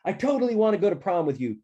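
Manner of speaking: high, fast, loud declaration; shouted over noise. A narrator's reading. Maggie accepts Taco over the noisy freshmen, shouting. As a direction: blurt it loudly over a crowd. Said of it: "I couldn't hear her over the freshmen".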